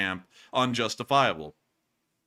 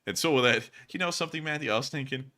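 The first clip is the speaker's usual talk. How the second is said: ordinary talk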